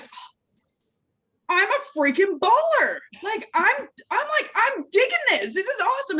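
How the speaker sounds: high, loud, hyped-up imitation; mock excitement. Coach acting out people who find they are ballers, exaggerated excitement. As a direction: burst out in high-pitched, exaggerated delight. Voices people suddenly feeling confident.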